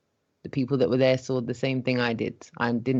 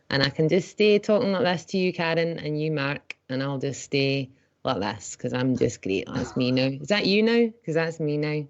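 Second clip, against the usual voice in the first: chatty Scottish lilt, playing it up; Scottish accent; 2 semitones higher than usual. Shown as a demonstration. [name] answers a listener request in a Scottish accent. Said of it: "I can do a wee Scottish voice like this".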